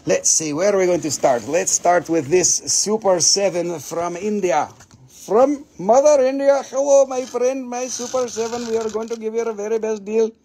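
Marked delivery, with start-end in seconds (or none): 5.1-10.3 put-on Indian accent. Seller voice for Indian stone.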